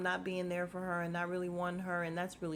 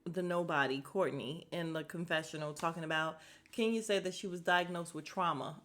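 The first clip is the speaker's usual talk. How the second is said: ordinary talk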